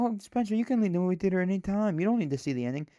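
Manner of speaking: high, fast, mocking patronizing friend; sing-song, talking down to Spencer. Friend mocks Spencer about leaving the theater, high mocking voice. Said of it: "And you go".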